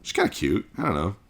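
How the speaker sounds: ordinary delivery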